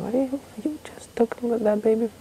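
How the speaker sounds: very high, hushed falsetto shriek; mock screaming. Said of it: "they scream and they yell".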